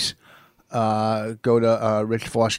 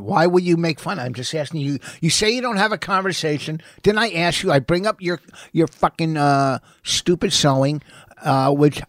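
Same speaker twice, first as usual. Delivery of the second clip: louder, higher, aggrieved arguing; indignant protest; 4 semitones higher, 5 dB louder than usual. Real, not acted. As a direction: fire back defensively after being mocked. Stung by mockery, quarrelling back.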